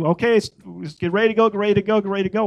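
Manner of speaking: urging call. Retold or imitated. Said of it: "all of us were packing".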